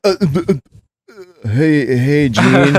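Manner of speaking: a hammed-up nervous hello; starstruck stammer. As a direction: stumble over the words in front of a hero. Retells his own starstruck stammer.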